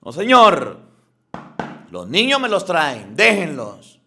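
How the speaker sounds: loud, commanding rebuke from Jesus; stern rebuke. Retold or imitated. Preacher voicing Jesus rebuking those blocking children, loud and stern. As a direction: scold them firmly and loudly. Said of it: "les dijo las cosas como era".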